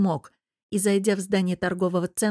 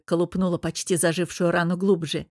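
plain reading voice

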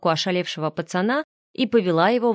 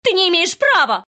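much higher, louder protest; hysterical scream; 11 semitones higher, 6 dB louder than usual. A narrator's reading. Вика screams back at Андрей in outrage. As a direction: shriek in outrage.

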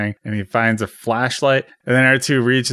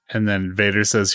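ordinary delivery

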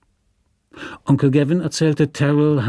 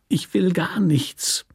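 slow, whispered near-whimper; almost whimpering, barely voiced; slow unvoiced whimper; 5 semitones higher, slower than usual. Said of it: "Er wimmerte jetzt beinahe".

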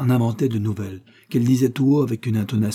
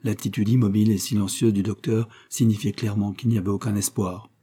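ordinary delivery

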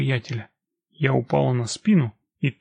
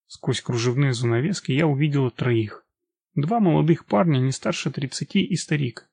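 ordinary delivery, nothing marked

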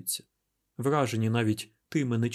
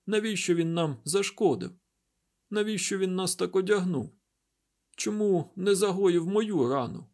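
higher-pitched, plaintive woman's questions; anguished questioning; 7 semitones higher, 3 dB louder than usual. A narrator's reading. Wounded Derkhan asks Isaac why, higher-pitched anguished questioning. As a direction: demand answers through mild distress.

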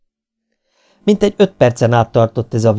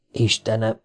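soft, hushed exclamation; understated whisper; 6 dB quieter than usual. A narrator's reading. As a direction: say it softly, in muted shock. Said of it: "suttogta falfehérre váltan Dawson". (visszafogott, halk suttogás)

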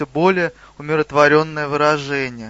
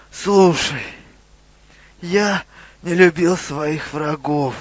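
dying creature gasping out a confession; halting, effortful phrases with long pauses; labored dying speech. A narrator's reading. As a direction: struggle to get each word out. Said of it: "с трудом выговаривая каждое слово".

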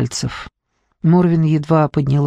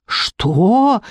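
drawn-out, higher, shocked question; exclamation; 4 semitones higher than usual. A narrator's reading. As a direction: let it swoop up in alarm. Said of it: "воскликнула Морвин".

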